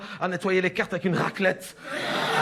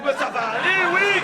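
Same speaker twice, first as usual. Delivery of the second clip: higher, louder mocking "mais ça va aller, oui" with a squealed "oui"; mocking sing-song; 8 semitones higher, 6 dB louder than usual. Wife mocking the doctor's reassurance, high and loud. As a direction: tease the reassurance.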